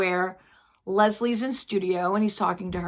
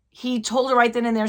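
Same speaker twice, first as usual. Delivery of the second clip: usual speaking voice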